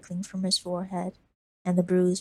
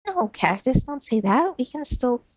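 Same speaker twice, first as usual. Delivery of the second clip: put-on higher, louder pleading boy; upset boy; 6 semitones higher, 5 dB louder than usual. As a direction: refuse to let her give up. Lizard protesting.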